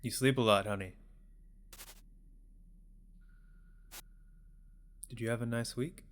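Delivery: put-on soft-spoken parent checking in on a child; gentle parent. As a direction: voice a caring parent, quietly. Voices parent talking to child.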